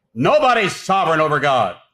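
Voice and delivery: forceful, high and loud declaration; emphatic preaching shout. Real, not acted. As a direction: thunder it out to the congregation. Preacher's climax after listing false sovereigns.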